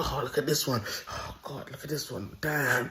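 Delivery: a pervert in his car ogling joggers, hushed leer; leering inner voice. Retold or imitated.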